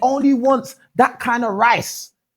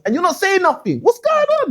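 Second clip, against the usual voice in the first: incredulous goading; 7 semitones higher than usual. Real, not acted. Host goading the silent panel.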